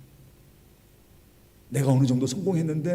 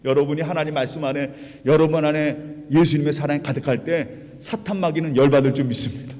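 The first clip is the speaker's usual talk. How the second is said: ordinary delivery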